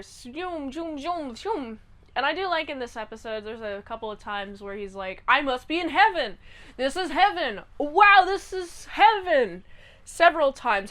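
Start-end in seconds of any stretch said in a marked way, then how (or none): 0.0-1.8 imitated zooming. Women sent out of here, mimicked zoom noises. 5.3-9.6 higher, marvelling exclamation; amazed delight. Retold or imitated. Rocky believes he has reached heaven, acted amazement.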